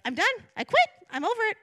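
high, loud quitting rant; worked-up "I've had it" cry; exasperated outburst. Retold or imitated. Retelling own outburst to pastor.

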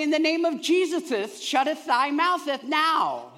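mock anointed preacher; King James rebuke. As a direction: proclaim it in fake King James. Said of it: "I'll say it in the King James Version".

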